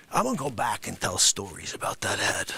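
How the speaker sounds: unvoiced low whisper; whispered threat between the shouts. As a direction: whisper it aside. Whispered aside.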